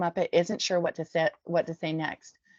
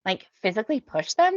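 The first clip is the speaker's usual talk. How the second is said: higher, louder mimicked question; mocking echo; 5 semitones higher, 4 dB louder than usual. Lisa throws the newcomer's question back, mocking. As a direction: parrot the confused question back, exaggerated and higher.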